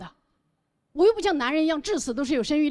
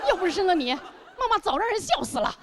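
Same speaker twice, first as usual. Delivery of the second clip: hammed-up reproachful mother; mock guilt-tripping; 3 semitones higher than usual. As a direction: lay a playful guilt trip on your child. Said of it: "我可能会去道德绑架我的孩子". (假装道德绑架孩子的妈妈口吻)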